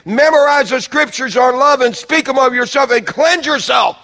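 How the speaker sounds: loud, high, driving sermon climax; full-force preaching. Acted. Preacher urging the church to cleanse themselves, loud and high. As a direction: preach it out hard to the congregation.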